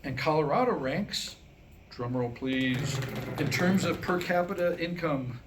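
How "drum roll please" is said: normal talking voice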